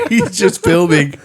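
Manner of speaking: ordinary talk, nothing marked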